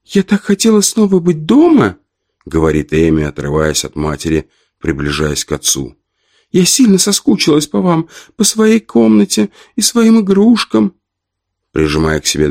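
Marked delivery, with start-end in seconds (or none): 0.1-2.0 put-on high child's voice; little girl voice. A narrator's reading. Girl speaks. 6.5-10.9 put-on high child's voice; little girl voice. A narrator's reading. Girl speaks.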